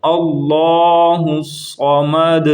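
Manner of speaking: صمد with thick emphatic ص, very slow; صمد with emphatic ص, slow chant; heavy saad. Shown as a demonstration. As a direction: draw out اللہ صمد with heavy ص. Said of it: "اس کو موٹا پڑھنا ہے".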